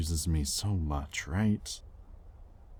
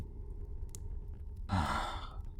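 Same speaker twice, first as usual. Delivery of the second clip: whispered sigh; 2 semitones lower, 9 dB quieter than usual. Acted. Weary alone in the room.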